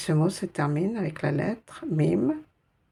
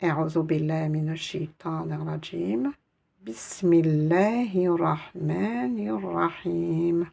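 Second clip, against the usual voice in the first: Quran recitation. Acted. Teacher recites the verse to the class in full.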